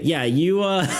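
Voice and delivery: normal talking voice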